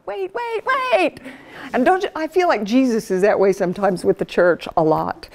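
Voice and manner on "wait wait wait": high, rising wait, wait, wait; a flustered husband calling out, much higher; husband reining her in. Retold or imitated. Husband holding back his headstrong wife, higher and calling. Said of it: "And Wayne's going".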